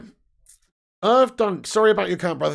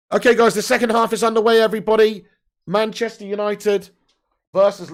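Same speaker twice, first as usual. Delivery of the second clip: higher and louder, announcing to the room; loud announcement; 6 semitones higher, 9 dB louder than usual. Real, not acted. Streamer loudly announcing the second half to viewers. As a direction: call it out like kickoff news.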